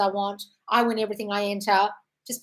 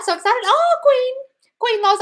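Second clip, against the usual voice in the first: 1 excited greeting; 8 dB louder than usual. Real, not acted. Greeting live chat viewers.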